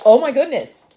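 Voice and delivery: loud startled exclaim. Real, not acted. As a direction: jolt in surprise. Finger nearly bitten.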